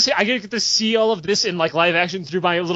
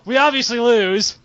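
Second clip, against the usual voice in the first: usual speaking voice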